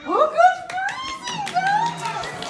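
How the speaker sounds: high, thrilled praise; excited praise. Acted. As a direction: delight the children. Praising frozen children.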